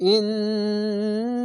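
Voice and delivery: ইন্নাল with the nn held long through the nose; nasal ghunnah held. Shown as a demonstration. Teacher demonstrates obligatory ghunnah, long nasal hold. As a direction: hum the ন of ইন্নাল slowly. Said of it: "এখানে ওয়াজিব গুন্না".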